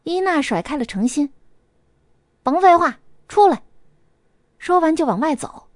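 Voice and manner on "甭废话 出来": loud, high, blunt order; angry challenge. Acted. Furious Ina orders the rival girl outside, loud and high. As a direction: spit it out as a dare.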